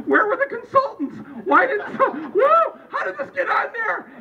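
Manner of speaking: very high, frantic squawking disbelief; mock panic. Voter panicking over the name on the ballot, mock frantic. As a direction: cry out in hammed-up panic. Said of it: "I was, like, in there going".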